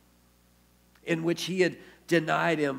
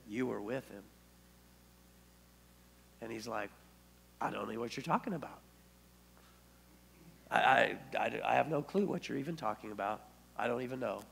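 a soft, flustered denial, far quieter; hushed acted quarrel; 5 semitones lower, 9 dB quieter than usual. Peter hushedly denying the servant girl's accusation. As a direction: play Peter brushing off the girl in a low voice. Preacher acting girl and Peter.

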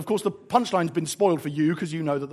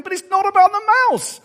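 put-on high, loud childlike outcry; protesting children; 13 semitones higher, 8 dB louder than usual. Children reading the story and protesting, loud and high. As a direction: wail it out like an exasperated kid. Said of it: "The children reading the story going".

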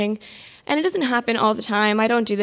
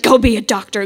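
louder, forceful mock parental command; imagined parent laying down the law; imitated firm order; 7 dB louder than usual. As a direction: voice a parent ordering their kid, with a sudden push of volume. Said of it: "taken me by the shoulders and been like".

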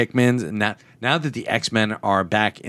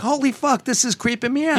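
freaked-out exclamation; 12 semitones higher than usual. Retold or imitated. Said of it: "and I'm like".

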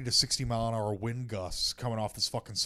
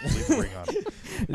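much higher, slow, laughing mock squeak; high slow teasing falsetto; 3 dB louder, much slower than usual. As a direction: squeak it out slowly through a laugh.

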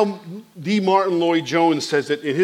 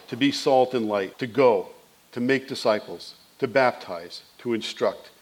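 closing prayer; 2 semitones lower, 4 dB quieter than usual. Acted. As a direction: pray quietly and humbly.

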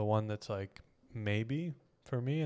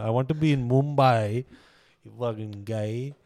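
mock Indian accent; 7 dB louder than usual. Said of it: "If Jon Snow was Indian".

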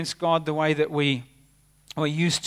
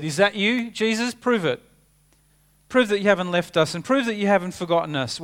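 higher, louder, challenging demand; doubting Thomas; 5 semitones higher, 5 dB louder than usual. Acted. Preacher voicing Thomas demanding proof from Jesus, higher and louder. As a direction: push back like a doubter wanting proof. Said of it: "When Thomas said".

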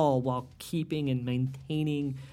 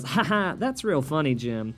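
phony host laugh into an overdone segue; hammed-up, fake-amused host voice; mock TV host; 7 semitones higher, 5 dB louder than usual. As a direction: parody a host's contrived lead-in, pitched up. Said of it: "Byron Allen goes".

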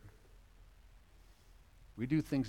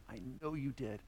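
much softer tender voice; Jesus answering his killers softly; gentle Jesus; 10 dB quieter than usual. Acted. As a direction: say it quietly, without blame. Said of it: "Jesus' response to them".

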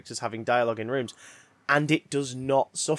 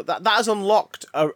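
ordinary talk, nothing marked

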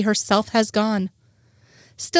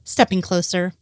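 usual speaking voice

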